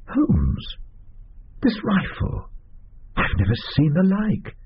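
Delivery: sudden high astonished exclamation; startled. Acted. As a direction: catch your breath in surprise. Watson startled by rifle.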